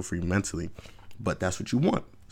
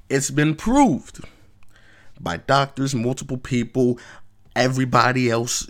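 emphatic insistence; 5 semitones higher, 6 dB louder than usual. Real, not acted. Vlogger insists doctors proved it, loud emphatic. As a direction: hammer the point home.

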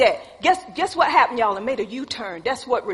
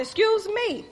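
high, affronted retort; indignant excuse me; 9 semitones higher than usual. Retold or imitated. Showing how she talked to God, high and indignant. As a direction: repeat the retort high-pitched, without shouting. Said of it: "that's how you talk to him".

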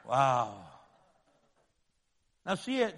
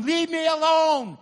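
shouted, drawn out, higher, slower; loud brush-off; 9 semitones higher, 9 dB louder than usual. Pretend. A rebellious person telling God to leave, shouting slowly. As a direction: yell it to push God away. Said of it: "even when you tell him".

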